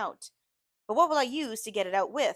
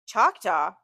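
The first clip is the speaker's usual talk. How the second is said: surprised; 8 dB louder than usual. Acted. Shocked echo of her word.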